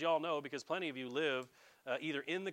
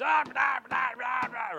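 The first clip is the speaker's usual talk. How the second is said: high, loud hollering tantrum; Lucy; 8 semitones higher, 9 dB louder, slower than usual. Retold or imitated. Preacher imitating an upset Peanuts character, shouted tantrum. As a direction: imitate an upset cartoon girl yelling nonsense. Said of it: "every time Lucy gets upset".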